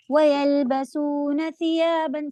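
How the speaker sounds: ثِيَابًا with a whistling ث, tongue tip out; correct recitation. Shown as a demonstration. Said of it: "سیٹی کی آواز لے کے". (ثِيَابًا زبان کی نوک سے سیٹی کی آواز کے ساتھ)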